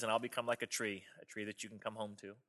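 very soft, low storybook voice; tender mother bunny. Acted. Mother bunny promises her runaway a home, very soft and low. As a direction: let it sink almost away, tenderly.

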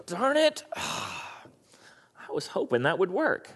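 high petulant "darn it", then a soft sighing mutter; mock disappointment. God, if he sulked at being told no, mock-disappointed sigh. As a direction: play God sulking at a refusal, tongue in cheek. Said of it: "he doesn't go".

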